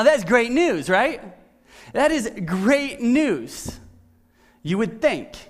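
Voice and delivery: normal talking voice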